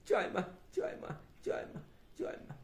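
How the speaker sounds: quick chant dropping to a whisper; fading to whisper. Acted. Thakur praying to Mother, fading into a whisper. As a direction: chant under your breath until it vanishes.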